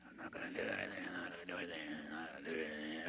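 very low, very soft strained mutter, held in; seething restraint. Retold or imitated. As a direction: swallow the rage and grind it out. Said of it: "you just kinda go".

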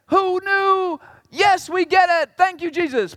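high, loud mock joy; imagined Pharisees cheering; mock delight. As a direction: gush like a convert. Mock joyful Pharisee reaction.

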